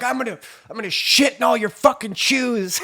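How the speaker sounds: high, loud imitated rant; screaming. Housemate storms through the house threatening everyone, imitated screaming. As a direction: mimic a screamed, ridiculous threat. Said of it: "just screaming throughout the house".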